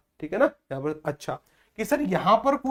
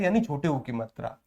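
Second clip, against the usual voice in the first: ordinary talk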